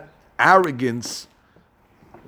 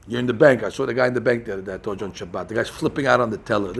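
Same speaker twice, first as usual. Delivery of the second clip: normal talking voice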